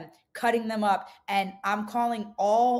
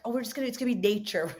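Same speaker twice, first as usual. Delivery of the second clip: breezy, fast mock-innocent voice; mocking naive self; 4 semitones higher, much faster than usual. Mocking her old idealistic self, breezy and quick. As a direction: play your old naive self with a light put-on voice. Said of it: "I'm like".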